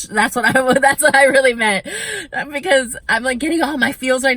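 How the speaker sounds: high, loud laughing aside; giggling at her own joke. Real, not acted.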